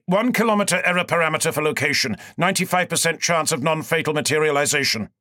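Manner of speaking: fast, clipped-off impatient orders; curt snap. A narrator's reading. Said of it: "I snapped".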